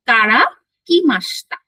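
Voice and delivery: teacher demonstrating কারা কি মাস্তা; modelled phrase. Shown as a demonstration. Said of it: "teacher models Japanese phrase".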